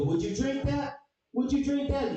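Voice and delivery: high, pointed challenge; mock scold. Presenter challenging a kid over the cup, high mock scold. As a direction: shove the cup at them. Confronting kids with mulch.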